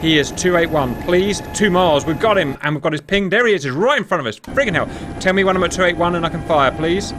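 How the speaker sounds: high, loud, thrilled; the hunt is on, barely contained; excited. Real, not acted. Closing on the enemy sub.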